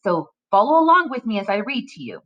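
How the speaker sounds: usual speaking voice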